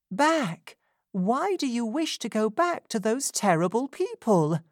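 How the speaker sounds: pleading Rose. A narrator's reading. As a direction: ask her not to go back.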